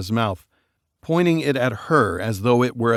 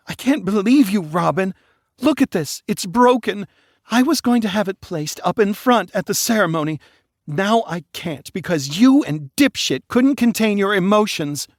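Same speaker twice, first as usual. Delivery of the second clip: much higher, shrieking blame; whiny berating; 10 semitones higher than usual. Acted. Bride berating her sister, whiny. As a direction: scold her for ruining it.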